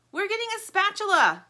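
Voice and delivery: high, loud shout to the yard; yelling. Acted. Plastic shouts the plan to Lumpy, yelling. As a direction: yell it out the window. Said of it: "yells Plastic".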